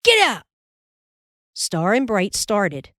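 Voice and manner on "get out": high, loud shout to the calves; high-pitched holler at the animals; shouted command. A narrator's reading. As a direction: shout it as a sharp driving order. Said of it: "cracked his whip and shouted".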